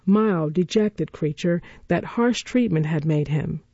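usual speaking voice

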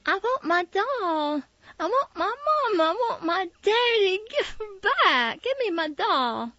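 high, loud wailing through tears; crying tantrum. A narrator's reading. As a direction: sob and wail for mama and daddy. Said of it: "stop that crying".

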